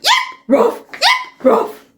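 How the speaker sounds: very high yaps swapped with barks; two dogs barking together; yips and ruffs. Retold or imitated. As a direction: trade off tiny yaps and woofs. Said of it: "Together they go".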